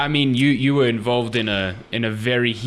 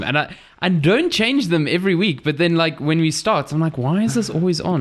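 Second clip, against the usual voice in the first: exasperated; 7 semitones higher than usual. Real, not acted. Exasperated about compressor light.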